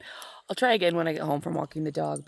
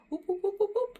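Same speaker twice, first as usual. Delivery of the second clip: high chirpy "whoop" calls; baby bird chirps; 12 semitones higher than usual. Retold or imitated. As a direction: mimic a tiny bird peeping. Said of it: "head was just like".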